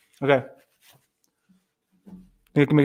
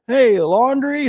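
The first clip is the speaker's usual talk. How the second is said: higher, louder, slow drawn-out call of laundry; calling out name; 9 semitones higher, 6 dB louder, much slower than usual. Retold or imitated. Taco Bell worker calling out his order name, loud slow call. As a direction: call the name across the counter.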